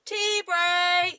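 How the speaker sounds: high, drawn-out call. Real, not acted. Co-host announcing a tea break, calling out. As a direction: sing it out high to announce a pause.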